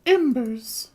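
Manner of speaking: high falsetto woman's voice; female voice. Acted. Noblewoman reassuring the shopkeeper, high falsetto. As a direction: lift it into a lady's falsetto. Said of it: "the most consistent quote-unquote female voice".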